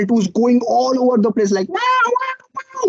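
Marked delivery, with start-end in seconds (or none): 1.7-2.9 high squeaky wow; stunned high-pitched disbelief; amazed. Retold or imitated. Amazed at eighty registrations.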